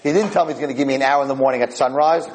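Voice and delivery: usual speaking voice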